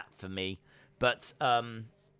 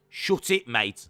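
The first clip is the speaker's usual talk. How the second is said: loud Brummie impression with depth; hammed-up Birmingham tough talk; Pete Dunne; 7 dB louder, slower than usual. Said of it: "depth to my voice to get to Pete Dunne".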